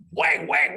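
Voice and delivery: high, loud mock whoosh noises; playful whang noises; kick sound effects. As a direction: make the kick sounds like a cartoon.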